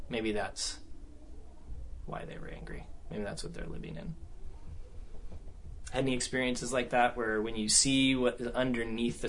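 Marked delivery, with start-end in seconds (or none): 0.1-4.1 hushed inner realization; soft private whisper of understanding; whispered thought. Retold or imitated. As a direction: whisper it to yourself.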